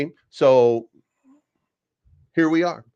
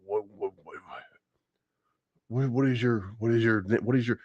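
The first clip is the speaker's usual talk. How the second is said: much softer, put-on, bewildered stammer; confused old man; 6 dB quieter than usual. Vlogger mimicking Joe Biden as a soft, lightly voiced confused old man. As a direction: mumble the words like a lost, confused old man. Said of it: "Joe Biden's gonna be like".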